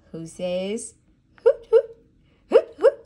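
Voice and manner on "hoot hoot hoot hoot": high, loud hoots; hooting owl. Acted. Mother hooting like an owl for her toddler. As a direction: hoot like an owl. Animal sound game for toddler.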